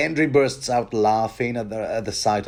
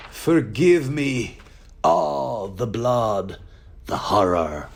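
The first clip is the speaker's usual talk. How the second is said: a hint of a dying man's horror; lightly played Kurtz drawing out his last words; Colonel Kurtz; slower than usual. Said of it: "I switched into Colonel Kurtz mode".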